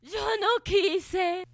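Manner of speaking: loud, slow sobbing cry; anguished wail. Acted. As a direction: wail it in despair. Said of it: "lloraba desconsoladamente".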